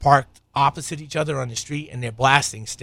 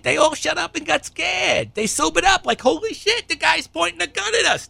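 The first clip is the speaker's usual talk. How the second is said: very high, squealing mock fright; mock panic; 3 dB louder than usual. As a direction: squeal it like a hammed-up scaredy-cat. Mocking the scared men's panic.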